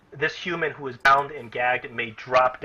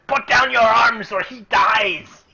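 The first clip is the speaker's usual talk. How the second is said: loud, high, slow goblin threat; goblin shout; 7 semitones higher, 6 dB louder, slower than usual. Acted. Goblin threatens the party with hostage, shouting. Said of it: "He, he says".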